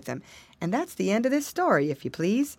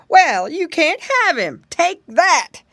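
loud, high, drawn-out defiance; acted defiant shout; defiant elephant; 8 semitones higher, 8 dB louder, slower than usual. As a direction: stand up to the villain at full voice. Elephant defies the alligator.

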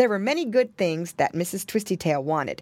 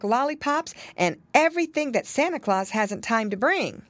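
lightly voiced mother, lilting higher; pig mother; 6 semitones higher than usual. A narrator's reading. Mama listing Christmas shopping to children, lightly voiced explaining. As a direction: explain it to the children in an animated lilt.

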